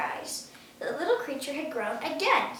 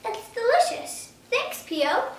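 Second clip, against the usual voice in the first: Otto pleased; 10 semitones higher, 3 dB louder, slower than usual. A narrator's reading. Otto praising the soup.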